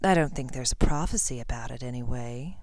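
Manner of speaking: low, soft, wicked aside; villain's quiet, cold afterthought, trailing down. A narrator's reading. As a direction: drop low into icy amusement. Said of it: "His cold voice pierced".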